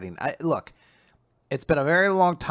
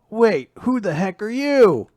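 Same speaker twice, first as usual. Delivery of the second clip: acted startled question; 10 semitones higher, 6 dB louder, slower than usual. Acts out hero's startled question.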